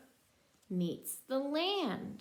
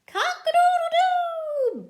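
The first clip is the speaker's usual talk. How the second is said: loud, high rooster crow; 11 dB louder, slower than usual. Retold or imitated. Crowing like the rooster in the book for her child.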